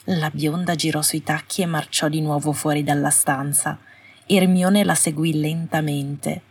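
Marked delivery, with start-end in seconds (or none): none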